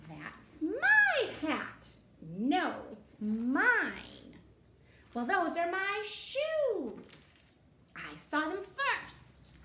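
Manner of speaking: high, slow whiny bickering; squabbling witches. Acted. Witches fighting over hats and shoes.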